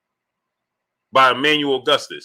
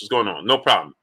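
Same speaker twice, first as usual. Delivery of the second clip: ordinary talk, nothing marked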